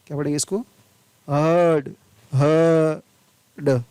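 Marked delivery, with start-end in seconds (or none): 1.3-3.8 हर्ड as "huhd", then split into long ह and short ड; no र in हर्ड, vowel drawn out; silent R. Shown as a demonstration. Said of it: "र नहीं पढ़ा जाता है". (हर्ड में र नहीं, ह लंबा)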